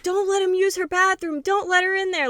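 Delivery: high-pitched panicked warning; alarmed high warning. Retold or imitated. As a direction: squeal it like an urgent alarm. Friends' repeated alarmed warning.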